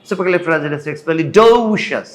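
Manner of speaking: supercalifragilisticexpialidocious rushed until a long DO; fast, DO stressed. Shown as a demonstration. Teacher showing only docious needs the drawl, stretched DO. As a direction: race through supercalifragilisticexpialidocious, land on "do". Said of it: "আপনি যত দ্রুত পারেন করেন".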